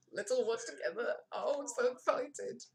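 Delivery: squeaky, gushing falsetto; hammed-up high-pitched thrill; mock excitement. Streamer awaiting the live exit, mock-excited squeal.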